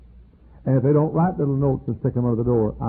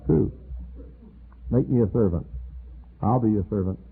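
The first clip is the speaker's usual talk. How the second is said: ordinary delivery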